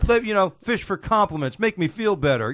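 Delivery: mock Rodney Dangerfield whine; Dangerfield impression. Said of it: "Are you doing Dangerfield right now?".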